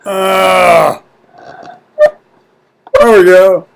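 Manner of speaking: normal talking voice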